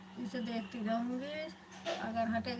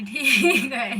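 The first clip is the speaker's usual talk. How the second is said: loud, laughing agreement; real laughter breaking through, much louder; 14 dB louder, much slower than usual. Real, not acted. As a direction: burst out laughing on the words.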